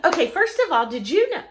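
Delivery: fast, loud, laughing rush of chatter; eager rush. Retold or imitated. Wife unloading her whole day on her husband, fast and excited. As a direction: spill every thought at once. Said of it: "I'm like".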